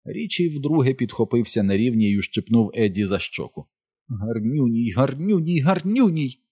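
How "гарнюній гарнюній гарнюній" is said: high cooing, climbing with each repeat; teasing exclamation. A narrator's reading. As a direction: coo it mockingly, higher each time. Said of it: "вигукував Річі".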